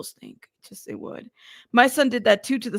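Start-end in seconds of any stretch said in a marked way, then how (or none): 0.0-1.3 breathy whispered aside; exasperated whispered trail-off. Real, not acted. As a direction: let it fall to a whisper. Exasperated about smelly costume.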